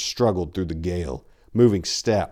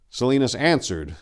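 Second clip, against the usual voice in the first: ordinary delivery, nothing marked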